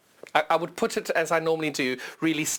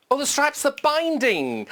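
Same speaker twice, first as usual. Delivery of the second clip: ordinary talk, nothing marked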